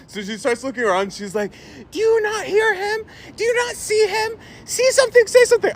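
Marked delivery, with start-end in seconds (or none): 1.9-5.7 very high imitation of an indignant passenger; hammed-up high complaint; mock outraged lady. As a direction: appeal to bystanders in a high mocking voice. Retelling lady appealing to passengers.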